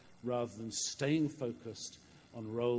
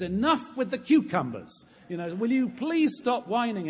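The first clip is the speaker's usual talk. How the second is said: loud, high exasperated outburst; fed-up, raised-voice rebuke; exasperated Moses; 10 semitones higher, 10 dB louder than usual. Acted. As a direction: voice a leader fed up with all the whining. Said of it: "Moses is just feeling the burden of it".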